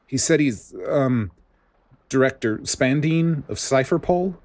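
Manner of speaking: a hint of a nervous young soldier. Soldier nervously announces caller.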